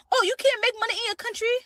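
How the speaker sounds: high, loud, fast mocking question; mocking questioner. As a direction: sneer their question back at them.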